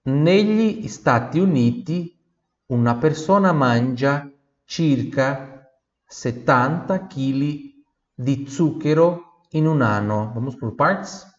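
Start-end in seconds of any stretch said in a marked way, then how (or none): 0.0-10.3 slow, careful Italian model reading; slow model sentence. Shown as a demonstration. Teacher reads the sentence slowly for students. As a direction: read it slowly for the class to repeat.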